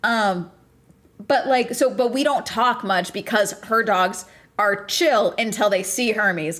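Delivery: ordinary delivery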